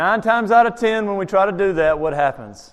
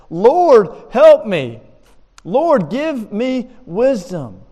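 loud, high plea to God; drawn-out imagined prayer; desperate prayer; 4 semitones higher, 5 dB louder, much slower than usual. Acted. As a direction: cry out slowly for help, mock-desperate.

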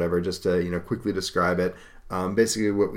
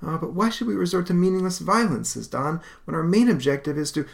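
put-on much higher, reasoning young voice; pleading objection; 9 semitones higher than usual. Don arguing against violence, pleading.

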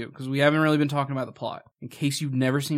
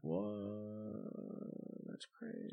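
whispered; 6 semitones lower, 21 dB quieter, much slower than usual. Real, not acted. Stunned quiet reaction.

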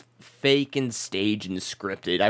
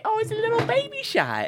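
put-on much higher cooing baby-talk voice. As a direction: coo it like talking to an infant. Cooing over a tiny shot.

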